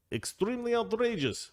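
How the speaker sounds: mock indignant officialese. Shown as a demonstration. Host mocks Kremlin phrasing, hammed-up indignation. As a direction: pitch "Extremely outrageous" up like a scandalized official. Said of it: "That's how they say it".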